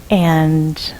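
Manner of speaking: usual speaking voice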